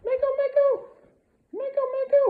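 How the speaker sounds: very high, loud excited squealing. Shown as a demonstration. Trainer proofing the dog's down, high-pitched squeal. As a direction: squeal the name over and over to tempt the dog. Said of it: "with a higher pitched voice".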